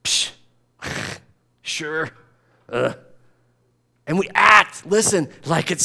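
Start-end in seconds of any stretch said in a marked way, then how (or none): 0.0-2.9 a quiet scoff, a sigh and a grudging sure; scoffing dismissal. Retold or imitated. People brushing off God with a quiet scoff. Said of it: "it's so dismissive of him".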